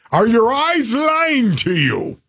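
high, slow Scottish coach yell; Scottish accent. Acted. Host imitates his old coach's yelling, Scottish accent. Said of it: "he said it with that kind of accent".

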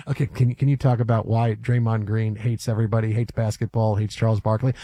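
low, flat mock press question; deep reporter voice. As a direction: play the pushy reporter. Mock reporter asks again.

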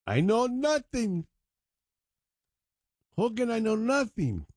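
high, drawn-out mock German accent; Schultz. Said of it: "not a very good German accent".

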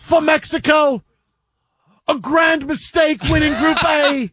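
put-on high, loud, pompous English accent. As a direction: proclaim it like an English pundit. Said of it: "in an English accent".

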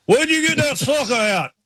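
the driver's line, replayed high; Ward Burton impression. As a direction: relish the punchline again. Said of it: "repeating the Ward Burton line".

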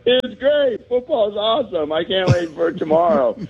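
put-on high, forced cheery kid; fake cheerful. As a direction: hide the pain, sound upbeat. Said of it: "I'm like".

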